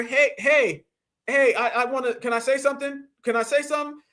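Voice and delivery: hammed-up shy person begging a turn; timid interrupter. Mocks a timid quiet person.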